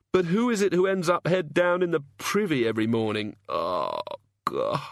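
a dazed, hungover god, slow and halting; lightly voiced sufferer, fumbling for words; groggy hungover god. A narrator's reading.

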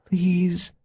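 high, thin plea; hushed, anxious pleading; whispered. A narrator's reading. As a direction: beg in a small high voice. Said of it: "Janet whispered".

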